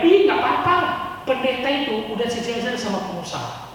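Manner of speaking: a nosy neighbour shrugging it off; gossipy onlooker. Retold or imitated. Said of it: "stigma pendapat orang".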